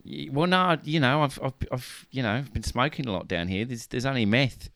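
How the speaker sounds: throaty mock-Kiwi voice; halting New Zealand accent, as if sore-throated. Peter Jackson explaining his sore throat, New Zealand accent. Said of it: "have you got a sore throat?".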